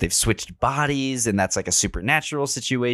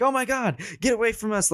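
mock terrified, pitched much higher; scared friends; 13 semitones higher, faster than usual. Friends fleeing the butcher, high mock panic. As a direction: shriek it like a scared teen. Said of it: "they're scared".

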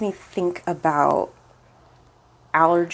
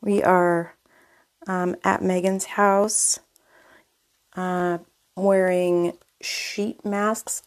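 ordinary delivery

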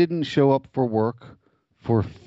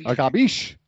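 Italian mobster; 3 semitones higher than usual. Acted. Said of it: "his job interview went something like this".

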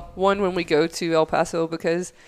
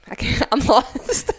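laughing lost; 5 semitones higher, 3 dB louder, slower than usual. Retold or imitated. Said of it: "And I was like".

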